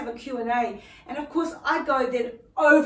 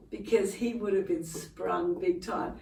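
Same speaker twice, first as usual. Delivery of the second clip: normal talking voice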